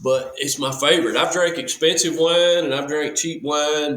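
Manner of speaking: normal talking voice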